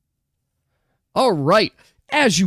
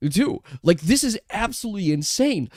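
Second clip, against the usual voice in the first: normal talking voice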